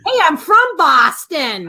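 put-on drawn-out, playful Boston accent. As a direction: declare it slowly in a Boston accent. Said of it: "ruining my Boston accent".

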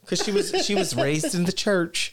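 put-on high-pitched country accent; teasing country impersonation; mock country woman. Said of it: "I make her sound like she's country".

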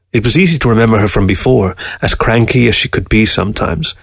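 usual speaking voice

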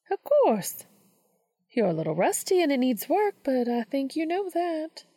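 kindly teacher reassuring her student; Missouri, gentle mentor. A narrator's reading. Missouri reassuring Dean about his poem, gently.

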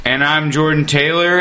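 ordinary talk, nothing marked

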